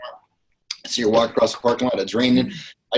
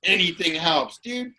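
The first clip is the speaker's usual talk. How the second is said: outraged yell; 12 semitones higher, slower than usual. Retold or imitated. Driver yelling at the ungrateful beggar, high furious rant. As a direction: blow up at him, laughing in rage. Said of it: "I go".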